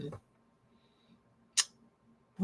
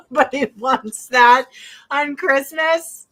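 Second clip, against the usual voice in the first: louder, laughing through it; laughing outburst; 5 semitones higher, 7 dB louder than usual. Real, not acted. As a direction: laugh out the words. Laughing at the salad story.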